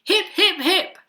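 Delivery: high, loud hurrah slowing to a grand finish; happy hooted cheer. A narrator's reading. Duckbills cheering their hero, happy hooted cheer. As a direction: lead a big triumphant cheer. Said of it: "They hooted".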